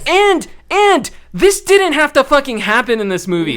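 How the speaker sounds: exasperated rant. Real, not acted. Ranting about the plot.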